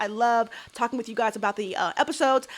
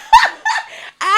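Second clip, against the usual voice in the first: shrieking high laugh; 7 dB louder than usual. Real, not acted. Bursts out laughing.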